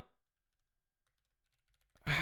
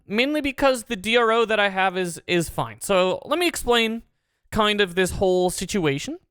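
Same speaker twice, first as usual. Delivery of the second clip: ordinary talk, nothing marked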